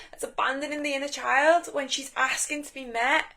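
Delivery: normal talking voice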